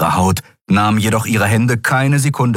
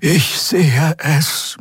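slow, drawn-out murmur, pitched up; murmured reply; 8 semitones higher, much slower than usual. A narrator's reading. Xea alone on the bridge answers, murmuring slowly. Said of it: "murmelte Xea auf der Brücke".

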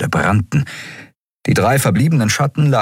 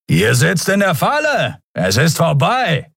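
taunting shout; 9 semitones higher than usual. A narrator's reading. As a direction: gloat that it's over. Attackers shouting threats.

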